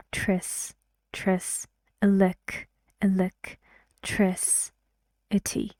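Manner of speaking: "electricity" slowly broken into pieces with pauses; syllable-by-syllable demo. Shown as a demonstration. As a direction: sound out "Tris" alone, then "electricity" slowly in chunks. Teacher breaks word into syllables.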